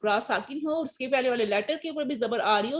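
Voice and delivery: ordinary talk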